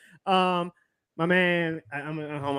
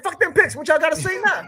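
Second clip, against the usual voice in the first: defiant mock; 8 semitones higher, 4 dB louder than usual. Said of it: "They said".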